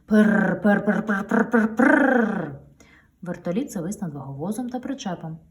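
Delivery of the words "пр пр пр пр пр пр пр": loud rotor buzz, long; loud helicopter. A narrator's reading. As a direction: helicopter right overhead. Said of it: "дуже гучним". (гучний гул вертольота)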